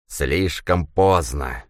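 slow whistling creaky voice. A narrator's reading. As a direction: hiss the words slowly, creaking. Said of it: "свистящий, скрипучий голос".

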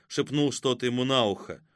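ordinary delivery

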